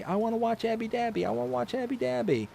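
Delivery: whiny little girl begging, lightly voiced; toddler asking. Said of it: "that's what she says".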